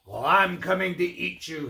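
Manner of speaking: storybook troll threat, lightly growled; a touch of menace as the troll; playful predatory warning; mock troll growl. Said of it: "in a low, slow growl".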